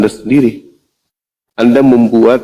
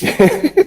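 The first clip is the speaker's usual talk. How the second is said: high laugh. Real, not acted. Amused at a comeback.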